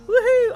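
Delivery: high, loud cheering whoop; excited whoop. Real, not acted. Streamer cheers Grey's new diorama plans, excited whoop. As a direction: let out a big celebratory woohoo.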